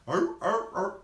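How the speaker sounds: loud barking arr arr; sea lion bark. Shown as a demonstration. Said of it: "and they bark".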